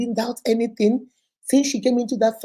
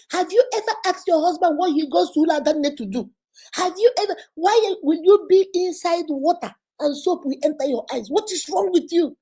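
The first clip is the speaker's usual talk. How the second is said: higher-pitched, exasperated scolding of a naive wife; scolding sister-in-law; 6 semitones higher than usual. Retold or imitated. Sister-in-law berating the wife for being blind, higher and exasperated. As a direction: berate her like a fed-up sister.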